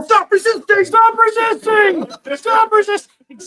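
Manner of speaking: high, loud hammed-up cop yell; repeated high-pitched pretend command, drawn out; mock police shouting. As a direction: shout it like a cop mid-arrest. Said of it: "He's like".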